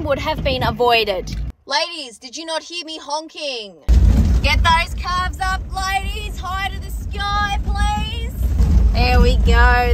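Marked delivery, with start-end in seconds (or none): 1.7-8.3 high, loud sing-song call to the flock; bright coaxing call across the paddock; calling the ladies. Real, not acted. Calling sheep up, mock aerobics coach. 8.9-9.9 loud, slow, drawn-out praise. Real, not acted. Sheep moving, praising them.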